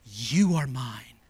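hushed fatherly assurance, with long pauses; tender father. Acted. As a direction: murmur it slowly, dwelling on YOU. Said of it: "He embraced us and kissed us and said".

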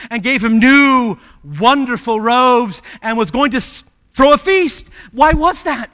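loud, rising pulpit excitement; rousing climax. Real, not acted. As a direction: build to a ringing question. Preacher builds the prodigal son story.

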